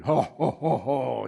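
lightly voiced booming giant chuckle; ho-ho it like Goliath mocking David; mock giant laugh. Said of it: "he's laughing at David".

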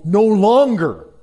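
"no longer" loud, high and stretched out; emphatic command. Real, not acted. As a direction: punch "no longer" with a rise then a fall. Preacher stressing the command.

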